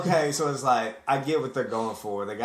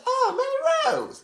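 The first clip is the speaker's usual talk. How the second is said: acted discovery. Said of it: "I said".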